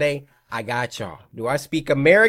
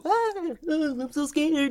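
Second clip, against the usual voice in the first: sarcastic fake fright, pitched way up and slow; mock scared; 14 semitones higher, 6 dB quieter, slower than usual. Joking to viewers after his own boo, high mock fear. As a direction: pretend to be terrified, clearly joking.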